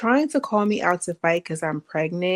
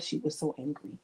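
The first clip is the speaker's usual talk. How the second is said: ordinary talk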